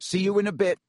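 high, put-on cheer over nerves; shaky attempt at confidence; forced confidence. A narrator's reading. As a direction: call goodbye bravely despite fear. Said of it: "inject some confidence into his shaking voice".

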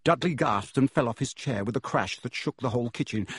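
plain reading voice

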